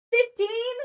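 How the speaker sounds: loud, high yelp of disbelief; disbelieving outburst; incredulous shout. Real, not acted. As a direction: blurt it out, shocked, way up high. Shocked at the page count.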